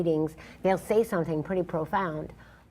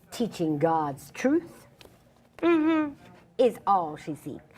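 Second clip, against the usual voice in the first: slow, declamatory, proud recitation; spoken-word poem; 4 semitones higher, slower than usual. Acted. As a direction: stretch key words and lift them defiantly. Performs her poem in the monologue.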